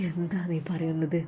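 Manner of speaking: hushed whispered disbelief. Acted. Friend questioning the risky plan, whispering. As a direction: whisper it, taken aback.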